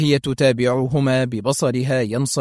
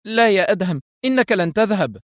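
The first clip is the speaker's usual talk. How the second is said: crying out; 7 semitones higher than usual. A narrator's reading. Wife bursts in to stop her husband leaving, crying out. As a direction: burst in with a high cry of refusal. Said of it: "اقتحمت سونيا الحجرة بغتة صائحة".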